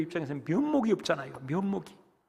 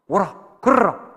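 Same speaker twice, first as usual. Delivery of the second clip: firm call; 4 semitones higher, 10 dB louder, slower than usual. Acted. Jesus commands Peter.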